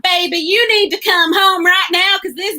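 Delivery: high, hammed-up Southern accent; mock a movie wife's terrible Southern accent; bad Southern accent. Reviewer mimicking the wife calling her husband home, bad Southern accent. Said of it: "she had the worst Southern accent".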